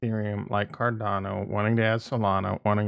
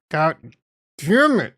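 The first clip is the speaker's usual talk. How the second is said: sharp, loud, high curse; pissed off; 4 semitones higher, 6 dB louder than usual. Real, not acted. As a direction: spit out the curse in real frustration. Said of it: "I am so pissed off right now".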